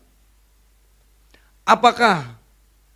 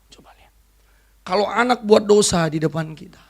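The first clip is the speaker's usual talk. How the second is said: ordinary talk, nothing marked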